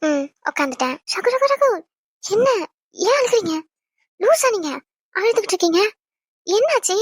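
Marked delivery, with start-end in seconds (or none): 1.1-7.0 higher-pitched, worried questioning; alarmed concern. Acted. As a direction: fuss anxiously over a crying husband. Wife finds her husband crying. (பதறிய அக்கறையான குரல்)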